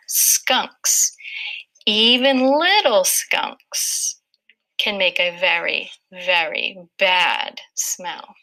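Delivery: slow read with a small breath at each dash; "Skunks, even little skunks, can make a very, very bad smell" with a little breath at every break; pausing at the dashes. Shown as a demonstration. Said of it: "listen as I read that part".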